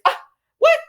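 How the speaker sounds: high startled squeal of disbelief; shocked squeal. Retold or imitated. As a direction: Jill caught off guard, squealing. Jill shocked, voiced by recapper.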